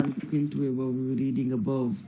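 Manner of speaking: too far from the microphone; fading out. Real, not acted. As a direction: voice drifting away from the mic. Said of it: "Your, your voice is fading".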